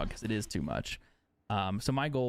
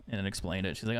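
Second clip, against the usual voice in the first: ordinary delivery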